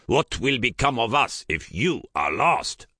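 put-on urgent plea in a thick Basque accent. A narrator's reading. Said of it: "thickened his Basque accent".

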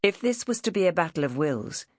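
usual speaking voice

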